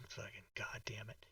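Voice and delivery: under-the-breath whisper; whispered curse. Real, not acted. Streamer cursing under his breath at his own mistake.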